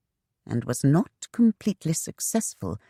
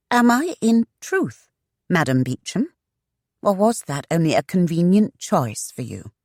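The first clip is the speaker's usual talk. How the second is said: raised, probing woman's voice; challenging young woman; 5 semitones higher, 3 dB louder than usual. Acted. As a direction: press him for the truth.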